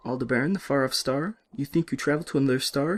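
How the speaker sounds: the nurse ridiculing his star travel; sarcastic French nurse; mocking French nurse. Nurse mocks.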